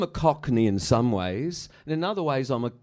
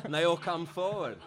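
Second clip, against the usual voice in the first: ordinary talk, nothing marked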